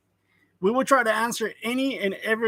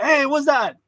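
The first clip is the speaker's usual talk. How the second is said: higher, louder suspicious challenge; mock interrogation; 6 semitones higher, 6 dB louder than usual. Streamer imitating border officer spotting his water, mock interrogation. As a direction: challenge him like an over-eager border officer.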